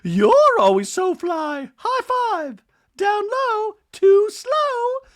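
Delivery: hammed-up squealing Dorit; mock Dorit. Host mimicking Dorit echoing Bose, squealed. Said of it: "Dorit's like".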